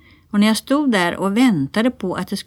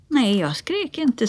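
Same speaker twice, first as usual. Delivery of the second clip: put-on higher, slow child voice; self-assured little girl; 6 semitones higher, slower than usual. A narrator's reading. Lotta telling mamma she was brave, self-assured. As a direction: draw it out like a small child.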